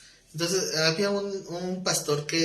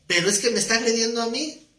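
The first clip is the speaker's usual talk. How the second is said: higher-pitched mock-offended protest; retold aggrieved complaint, pitched up; indignant church member; 8 semitones higher, 4 dB louder than usual. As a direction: act out a congregant who feels attacked. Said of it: "se enojaba con el pastor".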